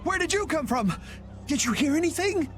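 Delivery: high, urgent questions; high-pitched, agitated surprise; startled questioning. Acted. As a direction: ask in startled alarm. Startled questioning of new arrivals.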